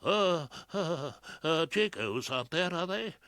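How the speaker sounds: high, stammering panic; flustered panic. A narrator's reading. Flustered barrister asking his colleague in court, in a panic. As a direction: stumble over the words in a fluster. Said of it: "whispered back in a sudden panic".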